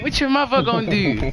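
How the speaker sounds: high, loud taunt; mocking taunt. Friend retelling the mother taunt, loud high jeer.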